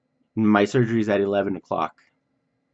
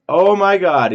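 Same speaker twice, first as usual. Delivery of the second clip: much higher, louder burst of relief; relieved exclamation; 10 semitones higher, 9 dB louder than usual. Retold or imitated. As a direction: exhale it like a weight just lifted. Surgery back on, relief.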